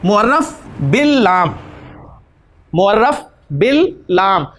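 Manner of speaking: मुअर्रफ बिल लाम spoken slowly, stretching the लाम in बिल लाम; teacher spelling out the term slowly; slow term drill. Shown as a demonstration. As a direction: drag out मुअर्रफ बिल लाम syllable by syllable for students. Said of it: "तो इसका नाम होगा जी".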